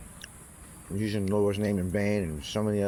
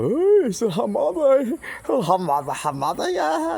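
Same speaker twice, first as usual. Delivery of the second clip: loud, high-pitched mock Chinese accent; funny mock Chinese accent; 6 dB louder, slower than usual. Mimicking Chinese people laughing at his Hamada, funny mock Chinese accent. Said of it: "I say it with a funny accent".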